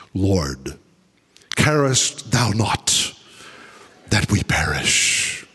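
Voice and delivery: low, slow, reverent scripture read; solemn King James reading. Shown as a demonstration. Preacher reading the King James verse in a low, solemn voice. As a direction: intone the old verse slowly and low. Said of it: "in the King James Version".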